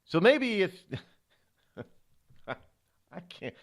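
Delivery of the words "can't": ordinary delivery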